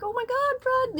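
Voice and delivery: high, loud alarmed shriek; panicked cry. Retold or imitated. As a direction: shriek his name in sudden panic. Imagined panic at the dog.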